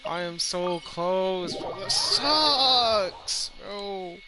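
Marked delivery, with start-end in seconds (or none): none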